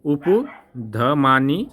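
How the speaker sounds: उपधमानी stretched very slowly, each syllable apart; slow syllable demo. Shown as a demonstration. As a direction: drag out उपधमानी for the class. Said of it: "इसको बोला जाता है". (उपधमानी बहुत धीरे-धीरे)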